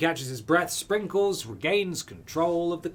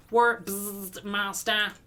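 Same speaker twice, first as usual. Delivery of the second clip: robot voice; 6 semitones higher, much slower than usual. Acted. Robot character Clank.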